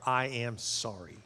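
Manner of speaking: soft, slow "I am sorry"; hushed sincere. Shown as a demonstration. Preacher showing the congregation the most powerful words, hushed and slow. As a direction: let "I am sorry" land quietly and slowly.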